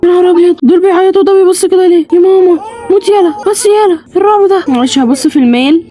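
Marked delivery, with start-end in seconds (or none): 0.0-4.7 scared. Real, not acted. Scared by crying babies.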